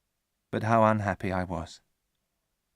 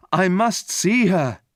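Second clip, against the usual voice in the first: slow, loud, higher plea; cried out; 8 semitones higher, 5 dB louder, slower than usual. A narrator's reading. Victor crying out to his father, slow and loud. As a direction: beg to be let through. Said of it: "I cried".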